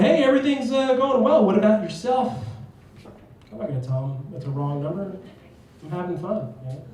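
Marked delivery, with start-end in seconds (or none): 0.0-2.3 high, chirpy pretend small talk; fake friendliness. Prankster posing as Connie by text, fake friendly. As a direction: greet a stranger like an old friend, pitched up. Playing along as wrong person Connie.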